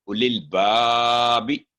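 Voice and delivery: उ लिल बा बी held long; slow recitation. Shown as a demonstration. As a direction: recite slowly. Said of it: "teacher models recitation".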